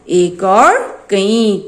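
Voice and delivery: "एक और कई" spelled out slowly, each word apart; slow drilled answer. Shown as a demonstration. Teacher drilling the class on one and many, slow and deliberate. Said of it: "आज हमने क्या सीखा?".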